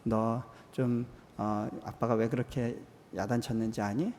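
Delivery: put-on gently calm the child down; soothing father. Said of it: "제가 이렇게 달래줬어요".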